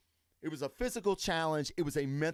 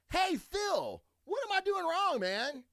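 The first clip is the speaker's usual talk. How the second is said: much higher-pitched pretend question; mock plea for advice; 13 semitones higher than usual. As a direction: play an amateur calling up to the pro, pitched high. Acting out asking a pro for help.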